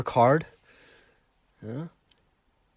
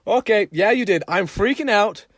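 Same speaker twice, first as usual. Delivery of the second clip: higher and louder, laughing nervously; flustered freak-out; 6 semitones higher, 5 dB louder than usual. Retold or imitated. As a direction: play yourself panicking after a crash. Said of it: "I should have just faced it and said".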